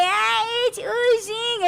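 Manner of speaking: high, slow, squealed horror; retold panic. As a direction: wail it out in disgust. Retelling panicked ear scare.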